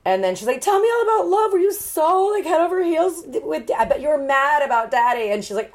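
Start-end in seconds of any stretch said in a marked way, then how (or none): 0.6-5.3 put-on high, gushing girlish voice; eager daughter. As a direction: gush questions like a lovestruck girl. Voices eager daughter questioning mother.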